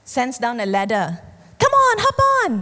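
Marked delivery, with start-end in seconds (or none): 1.6-2.5 much higher, louder call down from the helicopter; high, bright-volumed rescuer voice. Retold or imitated. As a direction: shout up an eager invitation.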